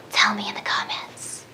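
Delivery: whispered request to the viewers. Real, not acted. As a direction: breathe it out in a whisper.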